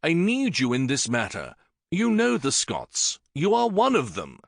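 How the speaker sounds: king pleads with Syn for help; almost pleading. Acted. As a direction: beg him without quite begging. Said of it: "with an almost pleading note in his voice".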